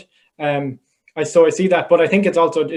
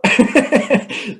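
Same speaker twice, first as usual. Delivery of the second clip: laugh; 7 semitones higher than usual. Real, not acted. Biting himself with gum shield.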